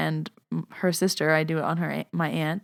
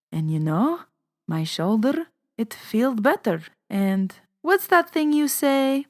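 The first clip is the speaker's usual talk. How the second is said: put-on slow, higher-pitched Lebanese old lady; grandma's Lebanese accent; 5 semitones higher than usual. Grandma on the phone to her grandchild about her shoulder, Lebanese accent. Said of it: "I wasn't gonna do the accent".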